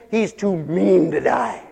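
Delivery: "mean" stretched out long and loud; drawn-out saying. Acted. Preacher quoting a saying, "mean" loudly drawn out. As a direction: lean hard and slow on "mean".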